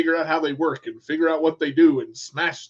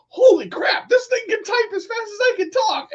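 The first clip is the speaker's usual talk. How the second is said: much higher, loud amazed shout; 5 dB louder than usual. Retold or imitated. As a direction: blurt it out stunned by the machine. Amazed at dictation software.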